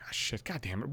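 whispered curse under the breath; annoyed whispered swearing. Retold or imitated. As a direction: hiss it to yourself, caught off guard. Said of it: "I'm like".

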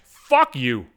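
normal talking voice

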